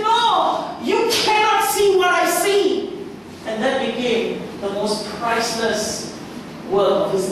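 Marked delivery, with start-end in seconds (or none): 0.0-3.1 high, slow, emphatic; high insistent artist, slowed; firm reply. Acted. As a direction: insist on your vision. Michelangelo answers firmly.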